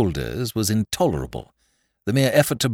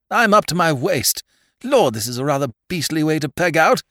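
high, raised voice from the bog; strained call; 9 semitones higher, 5 dB louder than usual. A narrator's reading. Peter up to his waist in bog, strained call. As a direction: call out, pitched up and loud.

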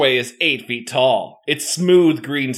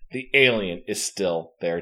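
usual speaking voice

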